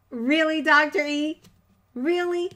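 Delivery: half-laughing mock indignation; mock disbelief. Real, not acted. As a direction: scold the teasing chat in jest. Mock outrage at chat teasing.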